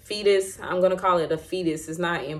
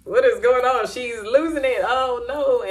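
lightly voiced panicking husband, higher; a hint of a guy who doesn't know what to do, pitched up; mock-flustered husband crying oh no, higher; 5 semitones higher, 3 dB louder than usual. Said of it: "So you know how guys get".

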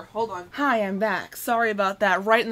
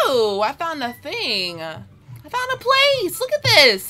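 higher, slow wondering exclaim; awed excitement; excited discovery; 4 semitones higher, 5 dB louder, slower than usual. Real, not acted. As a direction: marvel at the find. Discovering the fancy place.

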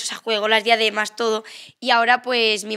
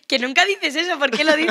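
higher-pitched puzzled protest; mock reproach; 5 semitones higher than usual. Granddaughter questioning grandfather's Granada word, higher mock reproach. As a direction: playfully take him to task. Child playfully challenging her grandfather's borrowed accent.

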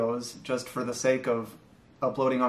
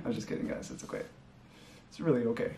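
whispered; 9 dB quieter, faster than usual. Real, not acted. Whispered aside after laugh.

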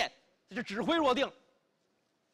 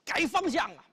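shouted order; 6 semitones higher, 5 dB louder than usual. A narrator's reading. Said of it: "吩咐一声".

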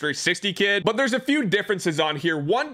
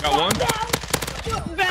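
excited. Real, not acted. Getting a kill.

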